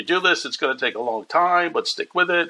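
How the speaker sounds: a hint of Rodney urging him on; Rodney's letter voiced. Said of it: "handwritten letter reminding me".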